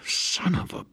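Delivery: breathy half-swallowed curse; cursing under breath. A narrator's reading. Harry, furious at Susan, swallows his curse. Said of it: "standing about cursing".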